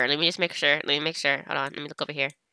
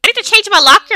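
mock outrage; 10 semitones higher, 8 dB louder than usual. Outraged at matching lockers.

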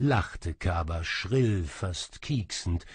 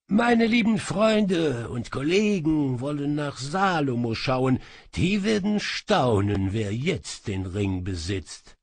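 higher, pleased gloating magician; smug sorcerer; 6 semitones higher, 5 dB louder than usual. Acted. Sorcerer gloats to the thief, high and pleased. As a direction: show off how you caught her.

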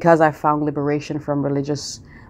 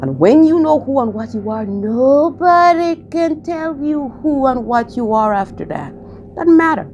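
rising preach; 9 semitones higher, 4 dB louder than usual. Real, not acted. Mystic vlogger urging viewers to know themselves, rising preach. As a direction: preach it up to the climax.

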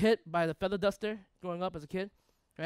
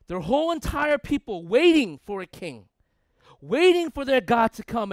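raised, forceful preacher's delivery; impassioned preaching; 5 semitones higher, 8 dB louder than usual. Real, not acted. Preacher to a congregation about a people waiting for a king, impassioned. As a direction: drive each phrase home to the congregation.

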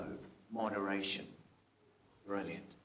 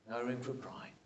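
softer, lower repeat of "No room for pride."; quiet echo; 4 semitones lower, 9 dB quieter than usual. Shown as a demonstration. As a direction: let "No room for pride." fall to a hush.

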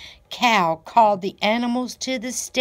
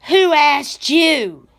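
slow, higher, louder catty retort; hissing cat; 6 semitones higher, 6 dB louder, slower than usual. A narrator's reading. Cat snaps at Goat in a slow hiss. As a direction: spit it out at Goat. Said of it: "hissed Cat to Goat".

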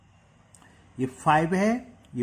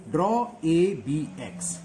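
slow, spelled-out dictation to students; teacher dictating slowly; 3 dB louder, much slower than usual. Shown as a demonstration. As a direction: dictate each word slowly for note-taking. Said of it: "step second में क्या लिखेंगे?".

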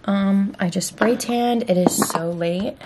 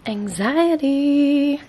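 drawn-out anxiety; 6 semitones higher, much slower than usual. Real, not acted. Teasing Cody about her nerves, slow drawn-out word. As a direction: stretch anxiety out slowly and higher.

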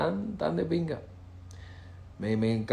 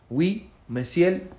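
"Oui, monsieur" lento y agudo con acento cubano fuerte; francés con acento cubano; 5 semitones higher, much slower than usual. Retold or imitated. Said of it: "Yo con mi acento cubanazo". (recordando París, francés lento con acento cubanazo)

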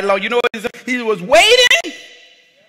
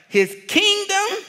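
ordinary delivery

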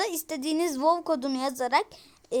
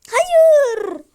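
shriek; 12 semitones higher, 14 dB louder than usual. Real, not acted. Gamer falls again in game.

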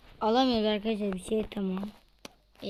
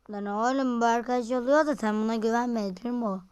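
ordinary delivery

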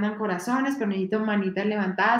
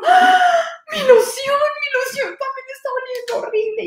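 much higher, louder anguished shout; horrified cry; 7 dB louder than usual. Acted. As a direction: cry out as grandpa discovers his cologne stinks too. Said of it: "la olió y dijo". (grito desesperado del abuelito)

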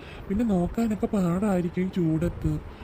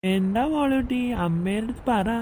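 father sweet-talking daughter to dodge the dishes; sweet baby talk; 2 semitones higher, slower than usual. Acted.

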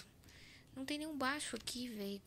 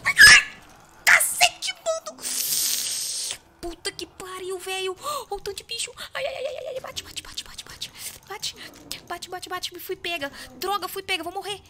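high, loud panicked shrieking; panicked screaming; 10 semitones higher, 13 dB louder than usual. Real, not acted. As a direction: scream in panic as it grabs you. Chased and caught by monster.

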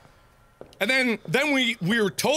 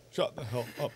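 very soft, low under-the-breath aside; fed up, barely voiced; hushed dismissal; 8 semitones lower, 10 dB quieter than usual. Real, not acted. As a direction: drop right down to a near whisper. Same words repeated under the breath.

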